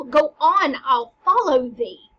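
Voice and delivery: loud, slow, resolute; resolute call. Acted. Teacher reading Hamlet, loud and determined to follow. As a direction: call after them, determined.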